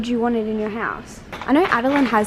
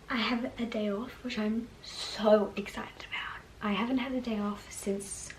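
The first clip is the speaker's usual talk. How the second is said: whispering; 11 dB quieter than usual. Real, not acted. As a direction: hush it, others asleep. Early morning, tripod, whispering to camera.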